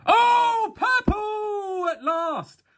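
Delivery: high, stretched shout of joy; excited shout. Real, not acted. Opening a pack, he yells when a purple finally appears. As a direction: yell out at finally pulling a purple. Rare purple sticker pulled.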